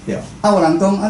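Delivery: usual speaking voice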